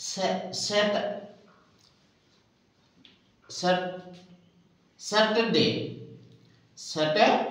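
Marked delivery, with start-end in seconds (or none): none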